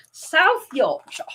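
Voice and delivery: slow "South Yorkshire", a full "South" with th; careful South Yorkshire. Shown as a demonstration. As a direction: show the proper "South", slowly. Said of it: "Look how your mouth goes".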